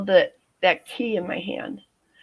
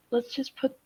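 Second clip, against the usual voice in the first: soft pleading; 5 semitones higher, 5 dB quieter than usual. Retold or imitated. Calming armed husband, quoted pleading.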